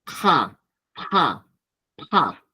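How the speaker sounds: "Ja, ja, pa" blown with a puff of air; strongly aspirated "Ja, ja, pa"; aspirated syllables. Shown as a demonstration. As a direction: breathe each of "Ja, ja, pa" out from the throat.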